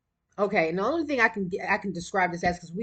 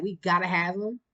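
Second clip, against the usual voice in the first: ordinary delivery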